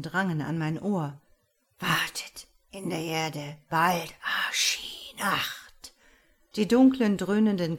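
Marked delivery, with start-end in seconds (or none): 1.8-5.9 slow, whispered murmur from the earth; eerie murmur. A narrator's reading. A strange voice murmurs from the grave, eerie whisper. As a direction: breathe the words like a ghostly murmur. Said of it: "ein seltsames Murmeln".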